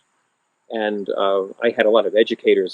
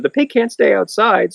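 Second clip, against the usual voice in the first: family insisting; 11 semitones higher, 3 dB louder than usual. Retold or imitated. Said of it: "Everyone said".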